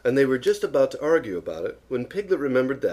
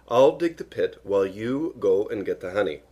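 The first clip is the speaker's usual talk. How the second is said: usual speaking voice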